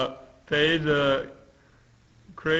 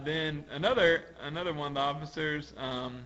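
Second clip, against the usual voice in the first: ordinary talk, nothing marked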